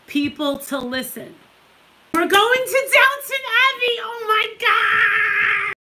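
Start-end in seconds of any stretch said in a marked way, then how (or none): 2.1-5.7 high, loud excited shout stretched into a cry; ecstatic, nearly crying; thrilled shriek. Real, not acted. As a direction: scream with joy at the news. Landing at Downton trip, shrieking.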